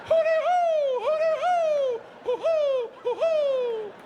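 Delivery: high loud hooty hoo calls; Crips warning call. Retold or imitated. Three on the dock hooting like lookouts, loud high calls. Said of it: "like three Crips letting them know".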